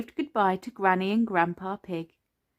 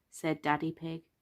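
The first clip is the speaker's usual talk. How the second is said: ordinary delivery, nothing marked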